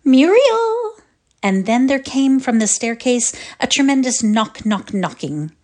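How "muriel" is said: high, loud call of a name; a schoolgirl's shrill cry across the hall; bird-like cry. A narrator's reading. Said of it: "A voice like a bird cried".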